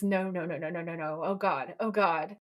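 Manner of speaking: ordinary delivery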